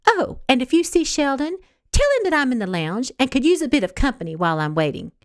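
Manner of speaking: breezy afterthought, a bit coy; lightly flirtatious; airy aside. Acted. Flirty request about Sheldon.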